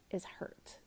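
hushed, unvoiced punchline; a quiet whispered revelation; whispered reveal. Real, not acted. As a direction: whisper the truth softly. Revealing hurt beneath anger.

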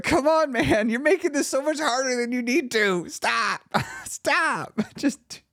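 high-pitched, pleading exasperation; imagined scolding of your past self, half laughing; exasperated urging. Retold or imitated. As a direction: grab your old self by the collar. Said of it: "go back and grab myself by the collar".

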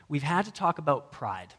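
normal talking voice

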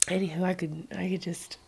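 breathy aside under the breath; whispering. Real, not acted. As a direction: drop to a whisper, half-laughing. Laughing aside about her horses.

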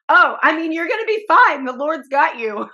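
put-on high, loud breezy child voice; imitating young daughter. Mom imitates daughter reassuring grandma, high and loud. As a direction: toss it off like a cheerful kid. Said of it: "And she goes".

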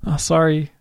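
quiet, understated mock apology; soft apology. Shown as a demonstration. Friend demonstrating an apology through a car window, soft. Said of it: "that's quite soft".

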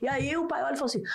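normal talking voice

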